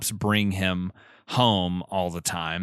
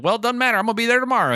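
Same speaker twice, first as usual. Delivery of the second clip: put-on much higher, brushing-off Southern accent; Southern caller; 11 semitones higher, much faster than usual. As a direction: wave her off in a high Southern voice. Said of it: "and he's like".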